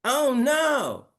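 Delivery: very high, drawn-up "Oh, no". Shown as a demonstration. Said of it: "Mohammed, repeat after me".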